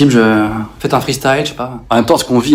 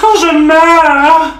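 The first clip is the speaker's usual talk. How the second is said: very slow, high wail; dramatic dying moan; 14 semitones higher, 4 dB louder, much slower than usual. Acted. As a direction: overplay dying of pain. (gémissement d'agonie lent)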